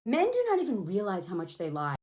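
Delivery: normal talking voice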